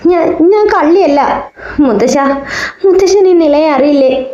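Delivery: high, pleading innocence as if near tears; pleading through sobs. A narrator's reading. Nila pleads with her grandfather, accused of theft, high and tearful. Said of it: "ഏങ്ങലടിച്ച് കരഞ്ഞു".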